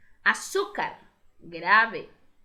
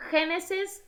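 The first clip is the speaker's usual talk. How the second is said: Gênesis said high, GÊ stressed; teacher repeating for the class; stressed model word; 7 semitones higher, 4 dB louder than usual. Shown as a demonstration. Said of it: "acentuada no G".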